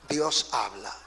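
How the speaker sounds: low, hushed pronouncement; solemn refrain. Real, not acted. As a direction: drop right down and state it softly. Solemn refrain answering each loud clause.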